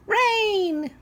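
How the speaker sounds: put-on very high, bright child voice; happy little boy. A narrator's reading. Little boy cheering the rain, very high and excited. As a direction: squeal it like a delighted little kid. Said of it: "He says".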